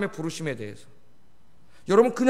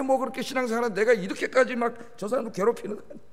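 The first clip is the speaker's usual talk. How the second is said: usual speaking voice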